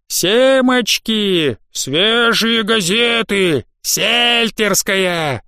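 high, long-drawn hawker's call; street vendors' cries. A narrator's reading. Boys hawking papers and snacks to a holiday crowd, drawn-out street cries. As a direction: draw each word out like a newsboy selling on the street. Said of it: "крики мальчишек-газетчиков и лоточников".

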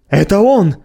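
high excited cry; exclaiming. Acted. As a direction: cry out in recognition. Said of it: "воскликнул обершталмейстер".

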